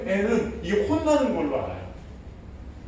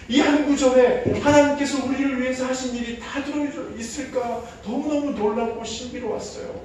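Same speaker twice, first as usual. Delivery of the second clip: normal talking voice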